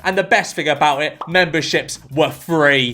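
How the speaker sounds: ordinary talk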